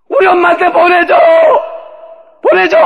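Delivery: 어린아이처럼 높고 크게 울부짖는 흉내; 엄마에게 보내 달라고 악쓰며 조르기; 울며 떼쓰기. Retold or imitated. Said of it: "그냥 내가 울었어요". (울고불고 떼쓰는 아이 흉내)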